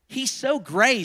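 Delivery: high, sing-song jibe from a storybook horse; lightly voiced horse passing judgment; horse's remark. A narrator's reading. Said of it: "said the horse".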